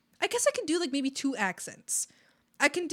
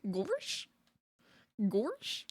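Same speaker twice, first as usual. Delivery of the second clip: lightly voiced cartoon "Gorsh"; soft questioning lift, cartoon-dog style; hint of a bumbling oaf; Goofy; 5 dB quieter than usual. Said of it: "like they're goofy".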